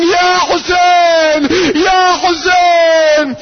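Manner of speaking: high, drawn-out mourning cry; screamed lament. Retold or imitated. Preacher voicing all of God's kingdom screaming, wailing lament. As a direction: slowly wail it out, stretching each word. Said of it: "entire kingdom of God screaming".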